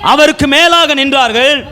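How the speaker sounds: high, ringing verse reading; preacher calling out the verse; declaimed scripture reading. Acted. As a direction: proclaim each question, pausing after it. Scripture read aloud.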